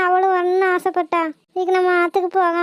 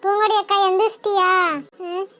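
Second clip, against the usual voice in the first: normal talking voice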